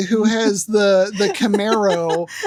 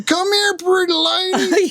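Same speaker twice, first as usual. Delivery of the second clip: mock biker catcall; 9 semitones higher than usual.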